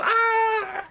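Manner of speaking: high sudden mock cry of alarm; startled yelp. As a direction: jump as if jolted by noise. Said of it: "you go".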